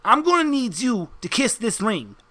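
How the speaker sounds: lightly voiced wiseguy, pressing the point; insistent mobster henchman. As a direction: coax the newcomer like a mob heavy. Henchman demands ring be kissed.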